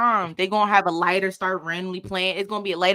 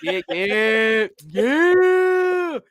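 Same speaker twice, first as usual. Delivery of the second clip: DC man's slick yeah; 2 semitones higher, 5 dB louder, much slower than usual. Retold or imitated. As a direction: stretch it out like a smooth DC guy. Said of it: "when they hit you with the "yeah".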